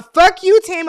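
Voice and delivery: hammed-up yell of fuck you; playful mock shout. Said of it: "It'd have been like a".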